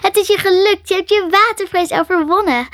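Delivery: high, loud burst of congratulation; jubilant cheering. A narrator's reading. Maree cheering Karen who conquered fear of water, jubilant. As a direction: cheer them on triumphantly at a high pitch. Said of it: "Maree juichte".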